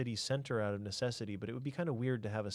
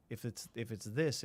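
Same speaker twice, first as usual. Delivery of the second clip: ordinary talk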